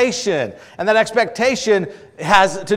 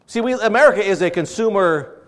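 ordinary talk, nothing marked

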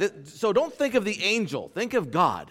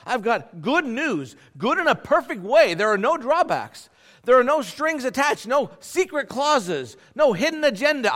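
higher, louder, building with joy; joyful proclamation; 8 semitones higher, 5 dB louder than usual. Acted. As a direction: proclaim it like the best news ever. God voiced, building joyfully.

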